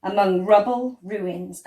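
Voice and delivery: ordinary delivery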